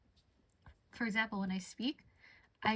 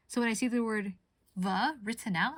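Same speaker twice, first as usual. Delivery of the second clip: ordinary talk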